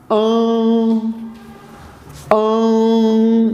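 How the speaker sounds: ان said as a nasalized "aan"; nasal an. Shown as a demonstration. Teacher modelling the nasal vowel, "aan" through the nose. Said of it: "ناک سے sound جس طرح".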